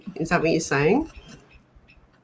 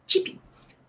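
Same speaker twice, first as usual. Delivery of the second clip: a playful whispered "Chippies"; 13 semitones higher, 6 dB quieter than usual. Real, not acted. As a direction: breathe the word out teasingly.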